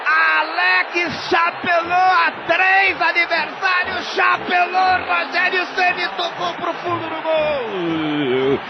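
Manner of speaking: high shouted goal call, stretched out; explosive goal commentary. Real, not acted. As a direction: scream the golaço with full force. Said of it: "com a narração do José Silvério".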